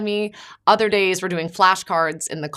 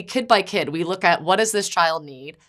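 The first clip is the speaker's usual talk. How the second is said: ordinary delivery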